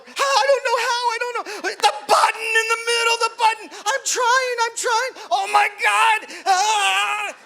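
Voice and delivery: high crying shout while stuck and shocked; panicked cries. Retold or imitated. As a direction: scream in helpless panic.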